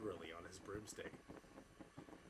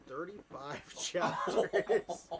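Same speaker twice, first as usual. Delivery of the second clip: high, loud and stretched out, laughing; laughing disbelief; 6 semitones higher, 8 dB louder, much slower than usual. Real, not acted. Reader revealing chapter count to friend, incredulous laughter. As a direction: draw the number out, breaking into laughter.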